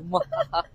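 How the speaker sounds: high thin evil cackle; breathy spooky mwahaha; evil laugh. Retold or imitated. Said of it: "it's like".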